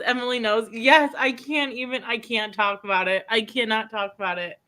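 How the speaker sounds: ordinary talk, nothing marked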